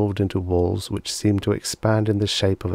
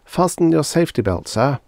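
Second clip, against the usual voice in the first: sweet stewardess; 8 semitones higher than usual. Acted. Robot stewardess.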